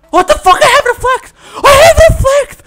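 high, loud indignant shouting; real high-pitched outburst; outraged yell. Real, not acted. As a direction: yell it in disbelief at the game. Outraged at a reflect bug.

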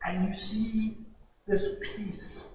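low, soft, near-whispered telling; hushed awe. Real, not acted. Preacher describing peace coming over the praying man, hushed. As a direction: let it fall to a reverent hush.

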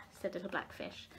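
usual speaking voice

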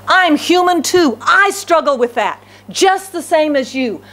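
high, impassioned preaching at full force; fervent preaching. Acted. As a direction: preach it out to the whole church.

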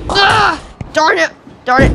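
high, loud cry of annoyance; exasperated shout; frustrated yell. Real, not acted. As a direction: burst out at a near miss. Player misses shot.

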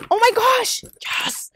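high, loud burst of delight; excited gasp. Real, not acted. Opening the next gift, a high excited squeal.

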